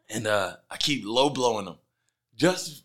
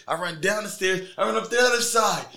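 normal talking voice

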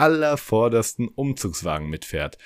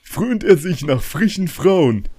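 grandpa voice; 9 semitones higher, 5 dB louder than usual. Retold or imitated. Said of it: "Und mein Opa meinte mal".

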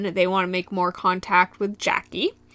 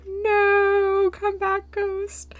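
mock plea; 12 semitones higher, much slower than usual. Zach imagined begging the ghost, high fading plea. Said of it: "And he's like".

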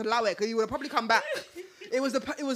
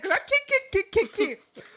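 high, louder, laughing retelling; busting up laughing; 9 semitones higher, 4 dB louder, slower than usual. Retold or imitated. Woman tells friends of her awkward laugh, busting up. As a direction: crack up while imitating your own laugh. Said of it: "when you start busting up".

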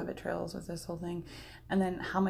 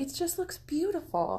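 quoted partner; 9 semitones higher than usual. Retold or imitated. Quoting supportive partner.

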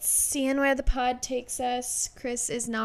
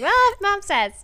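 high, louder mock-compliant child; mock obedient kid; 9 semitones higher, 8 dB louder than usual. Cohost imagining the daughter giving in, high mock child. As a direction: cave in like a naive kid. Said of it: "And you're like".

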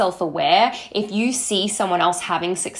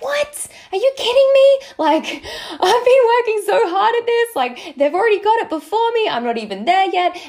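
high-pitched, exasperated disbelief, building louder; frustrated outburst; 13 semitones higher, 4 dB louder than usual. Retold or imitated. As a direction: squeal it like you can't believe they beat you. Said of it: "I would get really frustrated".

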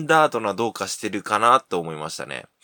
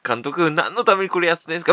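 fast protest; 6 semitones higher, 4 dB louder, faster than usual. Acted. Player asking the coach.

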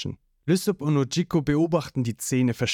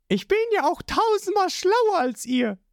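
put-on much higher, smug girl's voice; Nami's voice. A narrator's reading. Nami boasting to the crew, in a high smug voice. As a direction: brag in a high cocky tone.

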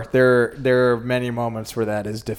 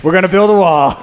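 mock Trump; 5 semitones higher, 7 dB louder than usual. Mocking Trump.

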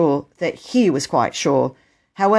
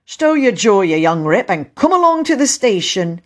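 loud, raised voice of authority; stern station master; 5 semitones higher, 5 dB louder than usual. Acted. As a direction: order a caught culprit along. Station master seizing the boy.

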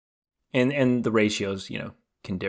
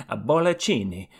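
hammed-up Italian flourish, pitched up mid-word; mock Italian; 3 dB louder than usual. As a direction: put on a playful Italian accent. Playing up Italian after pinky joke.

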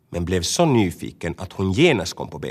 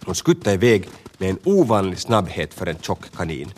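usual speaking voice

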